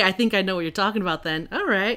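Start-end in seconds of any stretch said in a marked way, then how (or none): none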